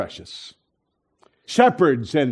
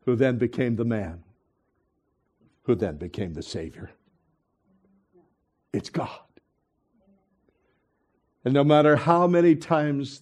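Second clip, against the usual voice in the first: hushed preaching; 7 semitones lower, 7 dB quieter than usual. Real, not acted. Pastor telling the church God came for them, hushed preaching.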